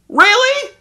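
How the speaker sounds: very high, loud squawk of disbelief; hammed-up disbelieving question; incredulous. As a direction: shoot the voice right up in outrage. Incredulous reaction to the film.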